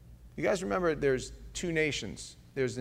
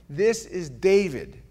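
ordinary delivery

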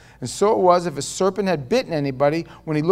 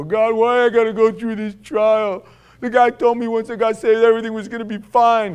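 a touch of mock whine, a little higher, to God; whining prayer; 4 semitones higher, 5 dB louder than usual. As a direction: play yourself complaining to God, half joking. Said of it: "I whine and go".

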